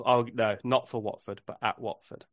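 ordinary talk

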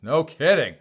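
loud sarcastic scoff. Acted. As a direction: throw it out with scorn. Sarcastic jab at survey findings.

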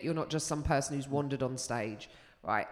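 usual speaking voice

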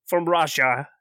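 offended mock Russian accent; Eastern European accent. Game master answering the mockery in a Russian accent. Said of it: "Terrible Eastern European cliches".

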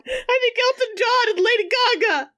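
very high, squeaky punchline; incredulous high squeal; high-pitched squeal. Acted. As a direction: squeak it out, fighting back laughter. Squeals the punchline through laughter.

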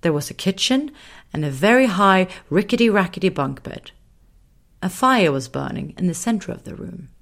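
ordinary delivery, nothing marked

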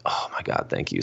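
whispered, overwhelmed thank you; a hushed, grateful gasp of thanks; half-whispered relief; breathless thanks. Retold or imitated. Overjoyed thanks for a bike.

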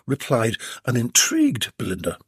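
usual speaking voice

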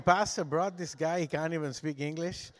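ordinary talk

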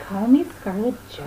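seductive movie star voice; sultry Scarlett Johansson voice. Retold or imitated. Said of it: "So she's like".

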